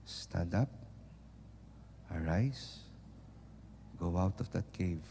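low, hushed, barely voiced call; God speaking softly to you and me; gentle whisper. Shown as a demonstration. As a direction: whisper it tenderly into the ear. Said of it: "very gentle, whispering voice".

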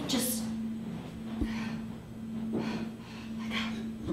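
barely voiced whisper; hushed dread. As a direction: breathe it out in mock dread.

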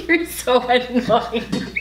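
laughing through the words. Real, not acted. Wife laughing at her husband's teasing, real laughter. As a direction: giggle it out at his teasing.

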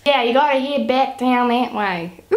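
slow, badly faked Australian drawl; hammed-up Australian local giving directions; Australian accent. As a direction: put on an exaggerated Australian accent. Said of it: "pretend I'm doing a really good Australian accent".

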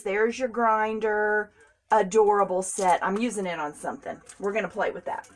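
ordinary delivery

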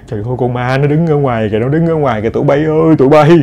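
put-on hushed, quick old woman's voice full of dread; scared old woman's voice. Grandmother warning the girls about the ghost outside, hushed old woman's dread. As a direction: creep them out like a terrified old granny. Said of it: "cái giọng của một người già lớn tuổi".

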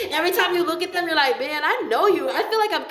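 high, loud, laughing through the words. Real, not acted. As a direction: crack up while exclaiming. Cracking up at her own description.

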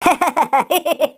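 short rising laugh out of frustration; nervous laugh. Real, not acted. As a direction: laugh from sheer annoyance. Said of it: "Vallahi sinirden gülüyorum yani".

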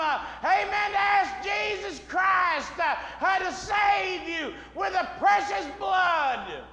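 high, loud, slow shouted altar call; fiery revival shout, drawn out; preaching at full force. Acted.

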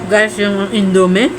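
usual speaking voice

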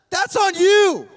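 preacher's punchline, loud and slow; emphatic. Real, not acted. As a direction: land it hard and slow on the crowd. Preacher building to a peak.

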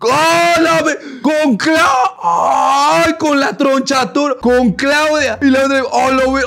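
loud, high shout of disbelief; real high-pitched shock; shocked outcry. Real, not acted. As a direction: cry out at a scandalous reveal. Shocked reaction to gossip reveal.

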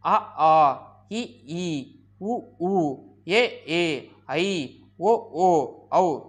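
each vowel alone, short then long, separated by pauses; vowel recitation. Shown as a demonstration. Teacher pronouncing twelve vowels for students, spaced recitation. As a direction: sound out the alphabet one vowel at a time. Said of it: "ஒரு தடவை உச்சரிக்கிறேன் பாருங்க".

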